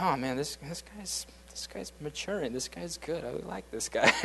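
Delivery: soft, hushed, impressed murmur; impressed aside. Retold or imitated. Two older coworkers quietly admiring Stasik's maturity, softly impressed. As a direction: whisper your approval to a friend. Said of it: "looking at each other like".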